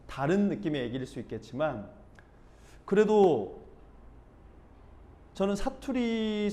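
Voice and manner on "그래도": normal talking voice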